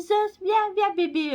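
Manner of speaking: put-on high coaxing baby voice; baby talk to cat. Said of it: "je faisais la gaga". (voix gaga aiguë pour appeler le chat)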